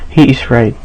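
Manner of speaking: ordinary delivery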